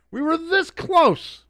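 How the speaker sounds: slow, high, dramatic meme line; meme quote. Retold or imitated. Quoting the Rogue One meme, high dramatic delivery. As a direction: ham up the movie line. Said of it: "having the Rogue One meme".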